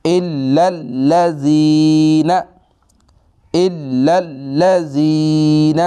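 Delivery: slow, high, chanted tajweed; slow melodic Quran reading; Quranic recitation. Shown as a demonstration. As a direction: recite الا الذین with both shaddas. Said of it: "ہم ملا کے پڑھیں گے".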